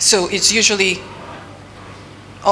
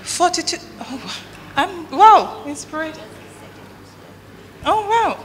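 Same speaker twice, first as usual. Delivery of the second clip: high-pitched amazed exclamation; wow, pitch jumping up in disbelief; surprised; 10 semitones higher than usual. Real, not acted. Reacting to audience investment answer.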